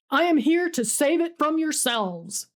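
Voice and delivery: ordinary delivery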